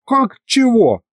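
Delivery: high-pitched incredulous question; astonished. A narrator's reading. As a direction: leap up in pitch, taken aback. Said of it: "поразился Михалыч". (поражённо, высоким голосом)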